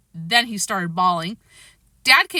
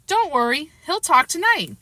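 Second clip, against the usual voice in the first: little Fudge; 8 semitones higher than usual. A narrator's reading. Fudge voiced as child.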